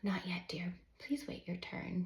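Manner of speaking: soft whispered reminder; whispered, patient mother; gentle reminder. A narrator's reading. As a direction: hush it gently to a child. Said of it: "she reminds him".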